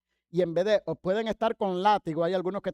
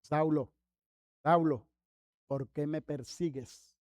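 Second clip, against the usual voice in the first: low voice, sinking softer at the end; voice from the light; 5 semitones lower, 4 dB quieter, slower than usual. Retold or imitated. Voice from the light calling fallen Saulo, low and solemn. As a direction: intone it low and measured. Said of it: "la voz le dice".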